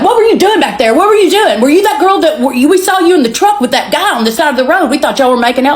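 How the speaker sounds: high-pitched, agitated imitation of rapid questioning; hyper questioning. Hyper passenger grilling a stranger about the truck, hyper questioning. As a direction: fire off accusing questions, wound up. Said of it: "he's so hyper".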